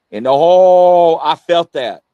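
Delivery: sudden loud startled "oh"; startled exclamation. Real, not acted. Host startled by a sudden feeling on the live show, loud startled cry. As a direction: jolt out the words as if something just hit you.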